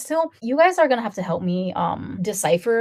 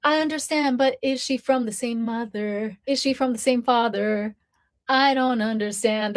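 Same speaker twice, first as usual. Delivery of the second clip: put-on puzzled meme voice, raised at first; TikTok trend imitation; 4 semitones higher than usual. Imitating a baffled parent from a TikTok trend, puzzled. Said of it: "that TikTok trend that I see".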